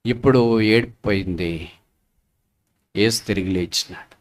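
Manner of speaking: low, hushed declaration; solemn preaching. Real, not acted. Preacher tells mourners that Jesus has risen, solemn and soft.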